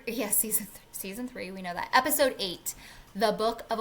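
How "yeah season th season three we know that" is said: unvoiced whisper; whispered aside. Real, not acted. Podcaster muttering to herself about season, whispered. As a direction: breathe it to yourself.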